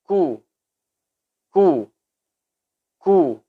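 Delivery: short ku, gentle release; kaf with dhamma. Shown as a demonstration. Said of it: "Saat dhamma".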